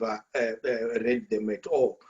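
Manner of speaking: usual speaking voice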